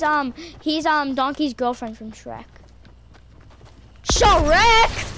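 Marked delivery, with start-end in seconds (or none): 4.1-5.1 shouted name, high and loud. Real, not acted. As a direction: yell it out. Shouting Shrek.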